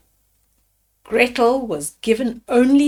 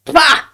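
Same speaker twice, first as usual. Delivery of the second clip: a louder, gruff bah of disgust; grumbled bah; 4 semitones higher, 6 dB louder than usual. Acted. Witch grumbling at the chicken bone, a gruff bah. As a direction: spit out the bah like a cross old witch. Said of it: "grumbled the witch".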